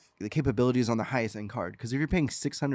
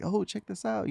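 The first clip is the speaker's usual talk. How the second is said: higher-pitched quote of an excited wife; 10 semitones higher than usual. Retold or imitated. Husband retelling his wife's reaction to the game update, excited. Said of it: "my wife was pretty excited".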